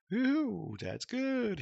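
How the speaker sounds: high, loud celebratory whoop; excited, drawn-out high cheer; delighted cheer. Real, not acted. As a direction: whoop it out as if it finally worked. Celebrates that the code worked.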